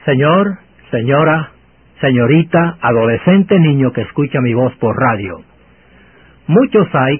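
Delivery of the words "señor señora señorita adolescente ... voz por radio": ordinary talk, nothing marked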